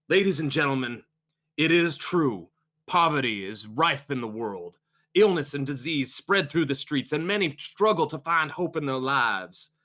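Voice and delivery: measured sermon with lifted phrases; solemn preacher. Acted. Preacher addressing congregation on poverty and illness, orating. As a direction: lament the world's troubles from the pulpit.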